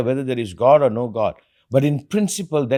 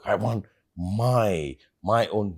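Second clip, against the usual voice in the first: mocking quote; 5 semitones lower, 5 dB quieter, much slower than usual. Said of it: "sometimes people say".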